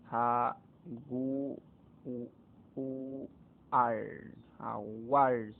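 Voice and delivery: jaguar broken into ja, gu, ar with pauses; syllables split, stress on A. Shown as a demonstration. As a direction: sound out jaguar piece by piece, landing on "ar". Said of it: "sobre la A recae la mayor fuerza de voz".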